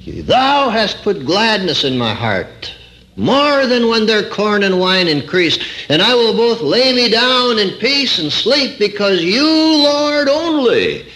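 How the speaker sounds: high ringing recited scripture; retold preaching. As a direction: proclaim the verse as from a pulpit. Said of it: "I was talking like this one time".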